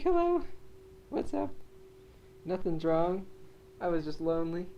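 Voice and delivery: high squeaky dog voice; dog's innocent voice. Retold or imitated. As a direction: voice the dog sweetly. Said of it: "He's like".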